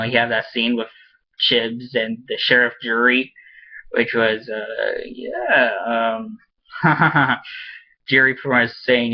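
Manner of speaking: usual speaking voice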